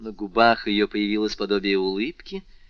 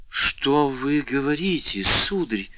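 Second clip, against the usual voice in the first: higher, slow, startled exclamation; восклицание; 5 semitones higher, 4 dB louder, slower than usual. A narrator's reading. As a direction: feign shocked disbelief. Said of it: "воскликнула госпожа де Вильфор". (изумлённо воскликнуть, выше и медленнее)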